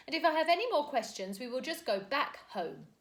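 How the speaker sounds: a brisk, sharp governess voice; clipped haughty nanny retort; stern nanny. A narrator's reading. Mary Poppins snapping at the children.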